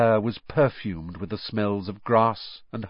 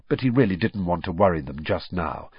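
ordinary delivery

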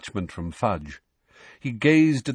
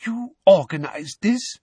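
high, incredulous question; aghast, pitch jumping up; shocked disbelief; 10 semitones higher, 4 dB louder than usual. A narrator's reading. Fudge questioning Dumbledore about the group, high and shocked.

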